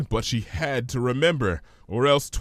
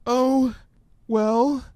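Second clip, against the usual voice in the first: high, hesitant frightened pony; Fluttershy's voice; 13 semitones higher, 4 dB louder than usual. Acted. Fluttershy fearfully reciting the ominous words, timid and high. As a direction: stammer it out nervously, higher.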